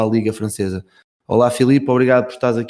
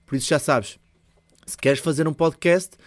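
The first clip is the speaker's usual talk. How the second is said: higher, upbeat sponsor read; 4 semitones higher, 4 dB quieter than usual. Acted. Podcast host reads a sponsor ad to listeners, upbeat ad read. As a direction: sell the app to the listener.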